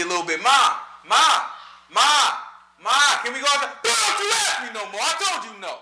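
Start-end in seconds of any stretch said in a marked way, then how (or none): 0.4-3.7 put-on higher, louder, insistent kid calling Ma; antsy child calling. Child nagging mom to go outside, antsy and loud. 3.8-5.7 higher, mock scolding mother; half-laughing mock mom telling off; mom snapping. Mom shutting down the kid, mock scolding. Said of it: "how your mom tell you".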